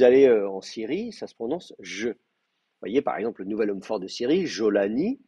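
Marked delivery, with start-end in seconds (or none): 1.8-2.1 JE with a very soft French j. Shown as a demonstration. Teacher showing how Syria says the consonant, soft JE. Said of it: "très doux, comme ça". 4.5-5.2 JOLANI opening on a very soft French j; soft Syrian j. Shown as a demonstration. Teacher citing a Syrian name, soft j in JOLANI. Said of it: "très doux, comme ça".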